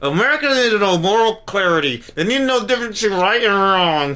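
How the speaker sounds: words squeezed through clenched teeth; through gritted teeth. Retold or imitated. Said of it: "why look like she's talking through her teeth".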